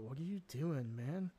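ordinary talk, nothing marked

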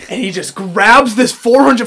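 normal talking voice

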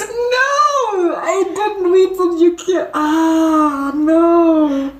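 high, whiny lament; mock despair. Listener upset at the story's killing, mock-despairing whine. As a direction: draw out a playful wail. Laments the character's coming death.

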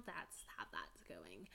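whisper. Real, not acted. Unvoiced trailing aside.

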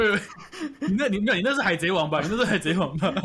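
high, laughing protest; laughing denial. Real, not acted. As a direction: laugh off the anime comparison. 笑着否认动漫比喻. (笑着否认)

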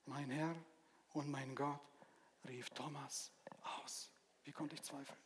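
hushed, breathless whisper of awe; awed whisper. Acted. Preacher voicing Thomas meeting risen Jesus, awed whisper. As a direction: breathe it out as if overwhelmed. Thomas's words in Bible story.

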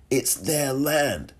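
"It's their land" drawn out slowly, each word weighed; slow down and lean on "land" as a final word; slow emphatic repeat. Real, not acted. To listeners about native communities, slow and insistent.

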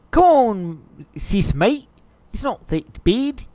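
coaxing hammed-up Aussie bloke; Australian accent. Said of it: "These are really good impressions".

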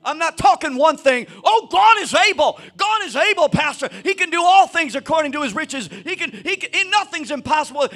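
loud, driving sermon build; preaching at full force. Acted. Pastor urging his church to walk in faith, full force preaching. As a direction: preach it up, pressing on every walk.

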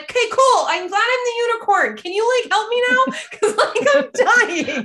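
very high, loud, stretched sarcastic whine; hammed-up dying patient begging; sarcastic desperate plea. Patient mocking doctor while in labor, high loud whine. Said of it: "And I'm like".

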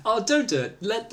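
usual speaking voice

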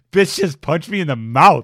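loud, drawn-out mock outrage; indignant kangaroo. Co-host joins in as the punched kangaroo, loud indignation. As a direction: play an outraged kangaroo, laughing into it.

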